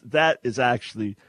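normal talking voice

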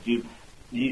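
normal talking voice

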